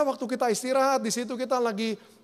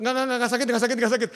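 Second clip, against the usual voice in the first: backtracking body; 3 semitones higher, 4 dB louder, faster than usual. Acted. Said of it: "Saya bilang".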